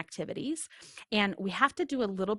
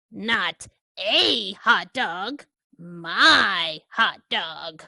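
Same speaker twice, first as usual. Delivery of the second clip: slow, louder pigeon leaning on "a" and "My"; possessive pigeon; 6 dB louder, much slower than usual. Acted. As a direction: correct the duckling, claiming it as yours.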